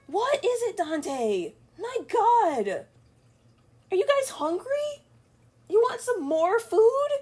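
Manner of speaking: very high, exaggerated cooing questions; pet-directed voice. Real, not acted. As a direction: talk to hungry cats in a high singsong. Talking to her begging cats.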